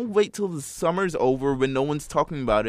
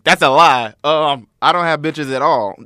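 loud mock quote; 2 semitones higher, 13 dB louder than usual. Mocking friend's denial.

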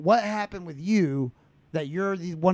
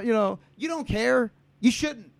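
ordinary delivery

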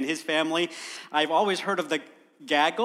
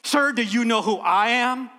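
firm mock-commanding comeback; unflinching stern retort; 3 semitones higher, 4 dB louder than usual. Chicken lady putting the governor in his place, firmly commanding. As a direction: throw the authority right back at him.

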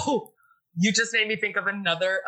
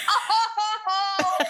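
laughing; 12 semitones higher than usual. Retold or imitated. Family laughing it off.

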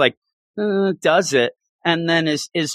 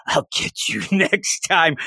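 dark threat; 5 semitones higher than usual. Retold or imitated. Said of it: "He gets real dark".

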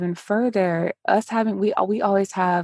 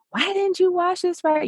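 sharp high scolding question; high-pitched parental scolding; mock scolding; 12 semitones higher than usual. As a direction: scold the kid about the dirty mug. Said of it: "instead of being like".

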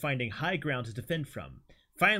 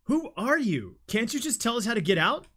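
high pleading call; 9 semitones higher than usual. Acted. Jim shouting at mask.

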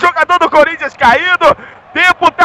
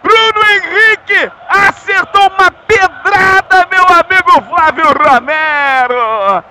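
explosive goal commentary; 3 semitones higher than usual. Real, not acted. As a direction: shout the scorer's name. Scorer celebration.

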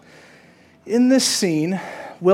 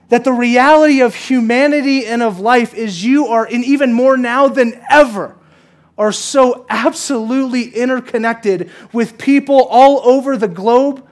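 louder, higher and slower sermon climax; full preaching; 7 semitones higher, 8 dB louder than usual. Acted. Pastor to congregation, louder, slower, higher climax. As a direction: lean in and drive it home slowly.

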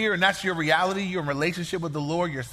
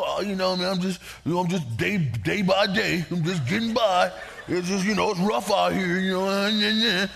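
droopy, dragging complaint. Retold or imitated. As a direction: play the bummed-out believer who's just getting by.

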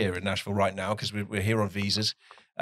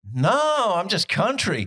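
put-on country accent; 9 semitones higher, 3 dB louder, much slower than usual. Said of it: "And she went".

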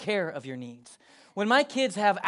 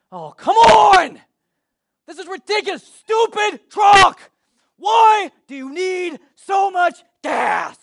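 much higher and louder, slow furious yelling; hammed-up rage at an empty truck; mock furious shout; 13 semitones higher, 15 dB louder, slower than usual. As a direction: yell at the stupid truck like it betrayed you. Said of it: "do you get angry at the car or truck".

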